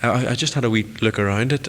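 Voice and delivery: normal talking voice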